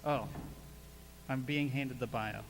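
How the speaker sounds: ordinary talk, nothing marked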